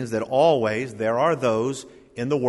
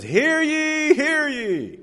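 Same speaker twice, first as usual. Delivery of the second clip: high, hammed-up English town crier; mock a puppet crier in a bad English accent; 14 semitones higher, much slower than usual. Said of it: "in this really bad English accent".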